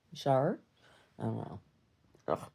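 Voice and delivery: usual speaking voice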